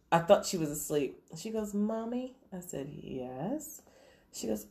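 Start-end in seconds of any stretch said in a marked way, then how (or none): 1.7-2.4 soft, higher little questioning voice; child calling mom. Retold or imitated. Daughter calling mom in bed at night, soft child voice. 2.9-3.8 soft, drawn-out questioning answer; hushed reply. Retold or imitated. Mom answering her daughter in bed, hushed.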